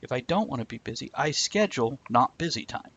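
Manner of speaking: usual speaking voice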